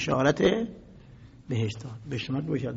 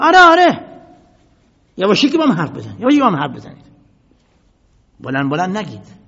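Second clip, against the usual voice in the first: loud, sneering taunt, higher at the start; mocking shout; 3 semitones higher, 14 dB louder, faster than usual. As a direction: jeer at them at full volume. Caliph mocking the whispering crowd. (تمسخر با فریاد بلند)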